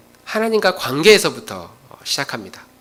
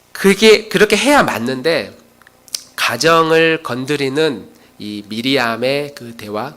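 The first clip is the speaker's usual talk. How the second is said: ordinary delivery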